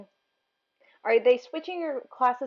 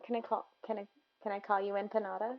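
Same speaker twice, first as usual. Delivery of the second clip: usual speaking voice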